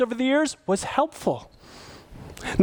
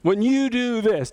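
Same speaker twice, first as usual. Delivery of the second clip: slow, higher accusing "you" statement; accusing complaint; 4 semitones higher, much slower than usual. Shown as a demonstration. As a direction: blame them, drawn out. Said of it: "Don't start with you".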